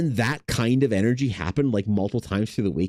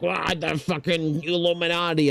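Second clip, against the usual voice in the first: higher, lightly voiced conspiracy ranter; mock crackhead; 9 semitones higher than usual. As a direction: toss off a half-hearted crackhead rant. Imitating a ranting crackhead.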